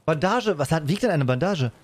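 normal talking voice

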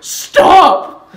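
huge high yell to halt everyone; commanding shout. Acted. King halting the marching kids, full shout. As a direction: scream it to stop them.

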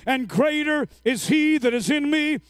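rising into sustained loud, high declaration; full-force preaching. Real, not acted. Preacher modelling worship in spite of circumstances, full-force preaching. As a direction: drive each phrase up and out, shouting the last ones.

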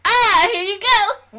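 high, loud, drawn-out outburst; exasperated yell. Retold or imitated. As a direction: throw up your hands and yell. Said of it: "it's just like".

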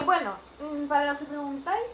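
usual speaking voice

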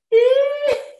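very high, loud posh titter; squealed posh exercise laugh; posh teacup laughter. Shown as a demonstration. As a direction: give a put-on, high-society teacup laugh. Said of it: "Let's do teacup laughter".

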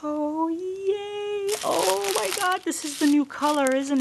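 high, delighted gushing; thrilled. Real, not acted. Vlogger discovering the new color in her package, thrilled.

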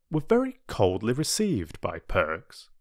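ordinary delivery

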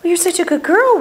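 high, lilting praise voice; high praise for dog. Real, not acted. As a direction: coo at a good dog.

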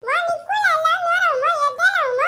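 high, loud comic shrieking; shrieking comic quarrel. Acted. Comic character shrieking during the hair quarrel, high and loud. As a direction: scream it in a comic fight.

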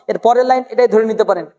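ordinary talk, nothing marked

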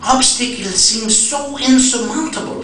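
put-on high, emphatic Ethiopian-accented protest; German de Sasa's accent. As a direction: burst in high with rapid no's, imitating an Ethiopian friend. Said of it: "is it not exactly how he talks?".

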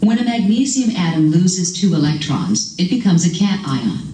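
narrated definition clip; recorded voiceover. Acted. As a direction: read like a textbook voiceover.

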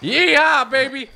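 high, loud cowboy whoop; yeehaw cheer. Real, not acted. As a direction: let out a real rodeo yell. Jumps on wild horse.

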